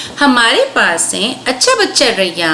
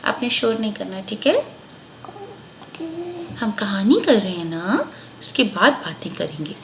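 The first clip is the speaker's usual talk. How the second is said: much softer, quick hushed words; quiet aside; 9 dB quieter than usual. Real, not acted. Mother quietly sends her child upstairs to play.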